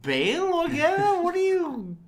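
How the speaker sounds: hammed-up desperate caller; squeaky mock plea. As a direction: stretch it out in a silly squeak. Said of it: "You're like".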